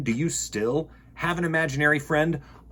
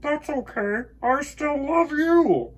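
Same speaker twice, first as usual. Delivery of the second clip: echoing moon; 13 semitones higher, 5 dB louder, slower than usual. Acted. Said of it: "said the moon".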